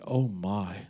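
low, soft, caught-out gasp; sudden embarrassed hush. Retold or imitated. Pastor realising he preaches to a cow, soft dismay. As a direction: drop to a startled hush. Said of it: "he stops and he's like".

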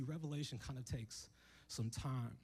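usual speaking voice

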